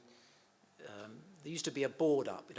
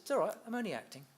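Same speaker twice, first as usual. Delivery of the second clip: cheeky reveal; 9 semitones higher, 4 dB louder, faster than usual. Retold or imitated. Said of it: "And he said".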